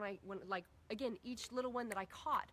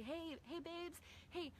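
put-on soft, light calling of the kids; gentle call; 7 semitones higher, 5 dB quieter, slower than usual. Mother softly getting her kids' attention, gentle. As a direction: coax them in softly.

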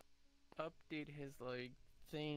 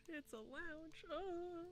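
high silly voice; 13 semitones higher, 4 dB quieter, much slower than usual. Pretend. Playful repeat of friend's line.

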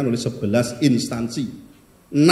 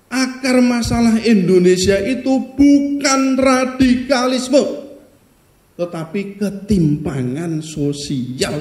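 slow, high and loud, leaning hard on bukan; emphatic slow declaration; 7 semitones higher, 3 dB louder, slower than usual. Acted. Speaker at a forum tells the audience the real cause, slow and emphatic. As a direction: drive home that the root is not radikalisme. Hammering home research finding.

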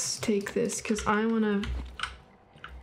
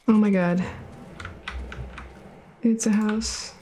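normal talking voice

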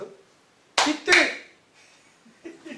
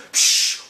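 fizz sound. Retold or imitated. Imitating fizzing tablet.

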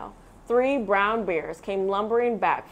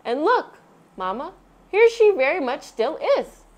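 lightly voiced Baby Bear; 8 semitones higher, 6 dB louder than usual. A narrator's reading.

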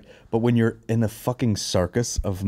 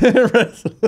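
put-on guilty kid; 13 semitones higher, 7 dB louder than usual.

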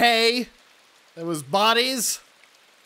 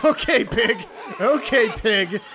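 laughing through the words; 4 semitones higher, 3 dB louder than usual. Real, not acted. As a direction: giggle at the silly pig. Laughing at pig.

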